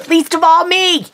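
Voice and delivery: high-pitched comic whine of protest; mock complaint. Real, not acted. Jokingly complains nobody is happy with accent.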